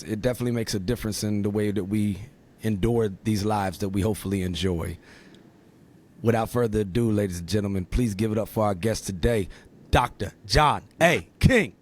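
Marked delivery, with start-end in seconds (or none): 6.2-11.7 big showman's build to the name; announcer introduction. Acted. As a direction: announce the guest like a ring announcer, drawing out the name. Podcast host announces guest.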